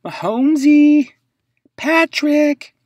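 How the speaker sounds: high, loud sing-song call of the name. Pretend. Fan hailing Mahomes' card, high loud call. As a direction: call his name like greeting an old friend.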